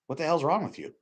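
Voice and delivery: ordinary delivery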